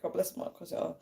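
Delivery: very soft, half-whispered recital; hushed self-quote. Retold or imitated. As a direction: say it under your breath, quoting yourself. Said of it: "I was like".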